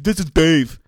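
loud put-on announcement; mock introduction. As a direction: blare the name in mockery. Mocking wife's introduction.

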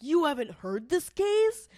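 loud, high, slow disbelief; incredulous. Retold or imitated. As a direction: act shocked they never heard. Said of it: "everybody was like".

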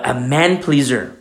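usual speaking voice

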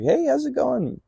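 imitated high-pitched hello; friendly greeting. Retold friendly greeting on meeting.

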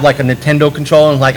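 ordinary talk, nothing marked

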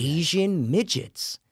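slow. Shown as a demonstration. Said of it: "That's a tongue twister".

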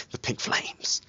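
whispered through a laugh; real whisper. Real, not acted. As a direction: breathe it out, nearly silent. Laughing aside to co-hosts.

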